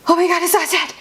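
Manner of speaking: high-pitched acted horror; retold freak-out in a high voice; mock panic. Retold panic as bat moves.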